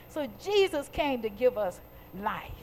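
ordinary delivery

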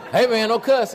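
high, loud teasing rebuke; mock scolding. Imitating his pastor catching him cussing, loud mock scolding.

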